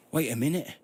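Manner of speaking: angry call. A narrator's reading. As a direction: call after them in anger. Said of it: "Karaman called out angrily".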